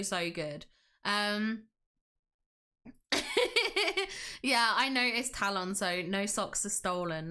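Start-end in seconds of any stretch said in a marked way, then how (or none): 2.9-4.4 high, rippling laugh; amused giggle. Real, not acted. Streamer reacting to a viewer about stolen socks, giggling.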